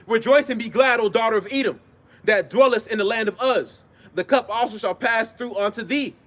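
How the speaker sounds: ringing scripture proclamation; scripture reading. Acted. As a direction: proclaim each verse with drawn-out line endings. Reads scripture aloud.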